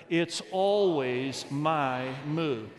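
very slow, stretched-out words; leading aloud together. Shown as a demonstration. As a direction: lead the congregation in a slow recitation. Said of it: "Let's say this aloud".

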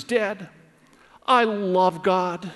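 slow, drawn-out mock tearful little boy; imitated crying child. Relieved son answers dad's mercy, imitated crying voice. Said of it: "he's crying and he's bawling".

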